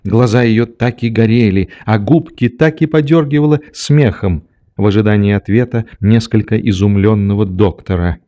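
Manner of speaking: plain reading voice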